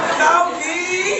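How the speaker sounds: loud, slow, laughing words; drawn out through laughter. Real, not acted. Friend at a memorial, laughing about James. Laughing after imagining him.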